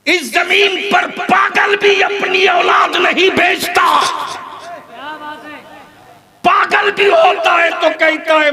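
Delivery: fiery sermon shout. Real, not acted. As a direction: hammer the crowd with a high shout. Preacher shouting at crowd. (ऊँची आवाज़ में जोशीला ललकार)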